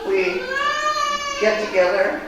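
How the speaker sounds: real tears catching the voice; choking up. Real, not acted. Thanking the congregation, voice breaking with real tears. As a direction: fight through tears to get the words out. Tearful testimony about grief group.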